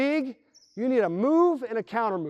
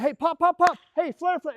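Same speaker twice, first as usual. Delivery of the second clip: higher mock play calls; calling actions; 9 semitones higher, 4 dB louder than usual. Player calling out actions, higher and louder. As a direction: call each action as it happens. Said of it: "And like".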